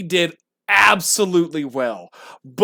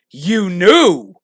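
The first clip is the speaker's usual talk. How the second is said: loud shocked quote; 2 semitones higher, 7 dB louder than usual. Retold or imitated. Quoting Peter's line louder.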